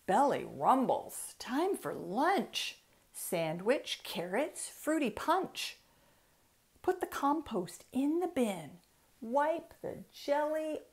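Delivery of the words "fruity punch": plain reading voice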